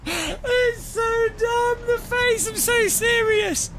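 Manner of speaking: high-pitched words squeezed out through helpless laughter; crying with laughter. Real, not acted. Streamer to chat over a silly replay, crying with laughter. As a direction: laugh so hard the words barely come out. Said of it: "I'm crying at how stupid that is".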